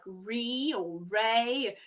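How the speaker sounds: "re" and "ray" as two slow, separate options; spelling pronunciations. Shown as a demonstration. Psychic explaining the spelling, sounding out "re" and "ray" slowly. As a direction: say "re", then "ray", drawn out. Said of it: "I know it's spelt R E".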